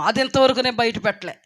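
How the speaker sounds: usual speaking voice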